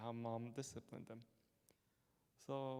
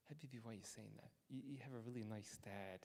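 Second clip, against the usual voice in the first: softer, fast gentle comfort; soft reassurance; 7 dB quieter, faster than usual. Retold or imitated. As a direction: soothe a frightened child. Priest comforts child in confession.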